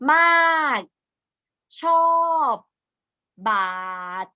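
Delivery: each word closed on a stopped consonant; hard endings. Shown as a demonstration. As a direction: stop the sound at the end of "มาก", "ชอบ", "บาด". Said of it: "hard mean the sound stop".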